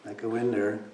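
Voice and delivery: ordinary delivery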